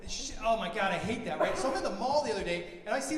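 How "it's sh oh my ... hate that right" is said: normal talking voice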